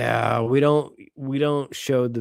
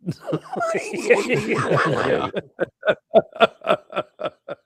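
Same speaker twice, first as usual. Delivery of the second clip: high burst of helpless laughter; long cackling laugh; 9 semitones higher than usual. Real, not acted. Cracking up over the harpoon scene, long high laugh. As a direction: crack up, trailing into staccato chuckles.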